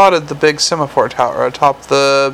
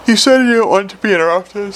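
high, defensive little imp voice; whiny imp defending itself; a small creature protesting its innocence; 6 semitones higher than usual. A narrator's reading. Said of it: "said the imp defensively".

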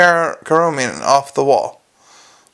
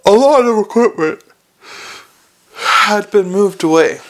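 ordinary delivery, nothing marked

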